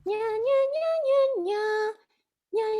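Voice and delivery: loud high nasal nya; nasal twang. Shown as a demonstration. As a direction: sing it through the nose. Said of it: "Can you try both of those?".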